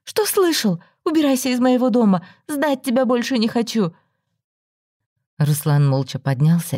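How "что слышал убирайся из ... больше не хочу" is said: furious dismissal. A narrator's reading. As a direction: drive him away for good. Throwing him out.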